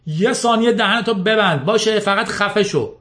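high-pitched exasperated outburst; father exploding in anger, pitch jumping up; angry yell. A narrator's reading. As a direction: cut him off with an acted yell. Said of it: "پدرش فریاد زد".

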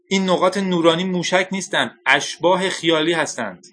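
high, emphatic assertion; urgent insistence. A narrator's reading. Scientist warns the general about false missiles, urgently insistent. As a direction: insist earnestly that he believe you.

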